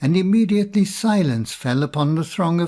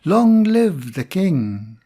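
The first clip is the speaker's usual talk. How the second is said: louder, slow, rising cheer of a crowd; 4 semitones higher, 4 dB louder, slower than usual. Acted. Crowd hailing Adam as king, loud joyful cheer. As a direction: hail the new king with a glad cry. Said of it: "And all the people cried".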